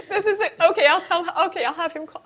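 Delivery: high, tearful giving in; high weepy acted voice; mock crying. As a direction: play sniffling through reluctant agreement. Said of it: "sorry about the crying".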